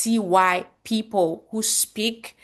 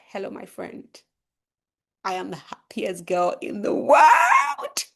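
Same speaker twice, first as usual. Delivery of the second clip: excited, confident; slower than usual. Shown as a demonstration. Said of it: "You can tell that I'm excited".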